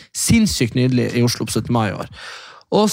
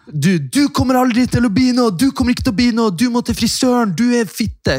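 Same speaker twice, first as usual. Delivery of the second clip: retold insult yelling; 9 semitones higher than usual. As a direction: shout insults to scare off teens. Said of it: "måtte jeg rope noe stygt". (hånende rop)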